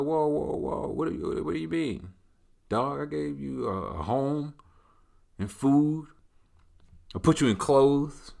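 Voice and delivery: high-pitched, stalling protest; taken-aback owner. Acted. Acted startled owner answering the dog.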